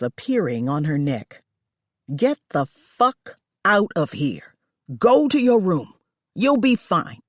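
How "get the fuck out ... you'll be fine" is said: louder, harsh commands; furious order. Acted. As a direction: bark her out of the room. Boss furiously sending her away.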